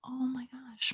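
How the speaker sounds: hushed, fast whisper of alarm; telling a friend, whispered panic. Retold or imitated. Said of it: "unvoiced whisper".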